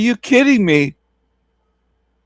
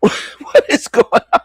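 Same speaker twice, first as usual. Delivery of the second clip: breathless laugh; 8 semitones higher, slower than usual. Real, not acted. Laughing at the video.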